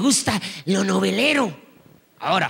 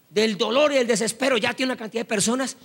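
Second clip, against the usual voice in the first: ordinary delivery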